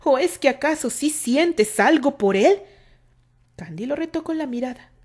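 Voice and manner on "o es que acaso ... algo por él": loud, slow jealous question; cold challenge. A narrator's reading. Terry coldly challenges Candy about her feelings, loud. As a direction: demand the truth. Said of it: "Terry la miró fríamente".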